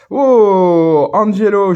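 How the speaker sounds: loud, high-pitched burst of delight; a drawn-out, thrilled "oh" of discovery; delighted surprise. Real, not acted. Collector spotting an unexpected card in his pack, delighted surprise.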